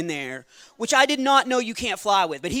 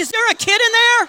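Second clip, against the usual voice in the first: much higher, louder startled cry; shrill-free mock shock, far up and loud; alarmed guard; 11 dB louder than usual. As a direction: panic as if a child is in the bag. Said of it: "He was like".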